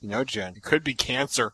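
a hint of a pessimistic mother murmuring; gloomy mother. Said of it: "her mother will look at it".

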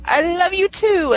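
high, slow sappy reply; drawn-out lovestruck answer; mock romantic lead. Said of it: "the second character would say".

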